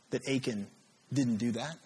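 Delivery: ordinary delivery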